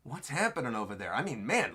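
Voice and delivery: usual speaking voice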